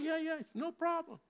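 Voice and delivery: high, breezy "Yeah, yeah, no problem"; chirpy character voice. Acted. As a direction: wave off the worry in a squeaky voice. Armless man brushes off concern.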